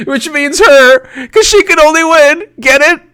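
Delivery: high loud laughing punchline; giggly high gag. Real, not acted. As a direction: laugh through the joke, high. Laughing joke.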